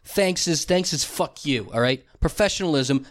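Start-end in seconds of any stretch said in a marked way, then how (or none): none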